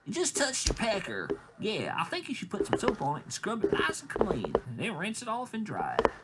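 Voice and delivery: high, mock-parental lecture; sarcastic instruction. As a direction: explain the obvious in a high mocking voice. Voices sarcastic reply to the kid.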